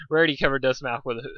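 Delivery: plain reading voice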